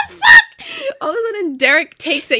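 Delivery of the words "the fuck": very high, loud; laughing shriek. Retold or imitated. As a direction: scream it laughing. Shocked friends shouting.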